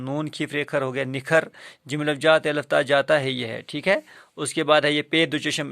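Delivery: usual speaking voice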